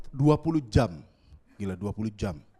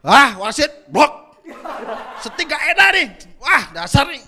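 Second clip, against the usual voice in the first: much louder, higher yelling at the game, laughing; shouting gamer; 9 semitones higher, 13 dB louder than usual. Pretend. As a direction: shout at the ref like a furious football gamer. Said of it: "Dia lagi main". (teriak keras dan tinggi marah ke wasit sambil tertawa)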